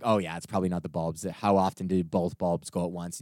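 a hint of a shrugging shop worker; lightly voiced store clerk. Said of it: "So they're like".

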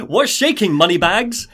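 high, loud cheesy hail; hammed-up slick hello; jokey greeting. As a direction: call out like a cheesy wise guy. Joking impression of fedora greeting.

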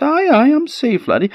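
high mother's voice; a Scots mother reassuring. A narrator's reading.

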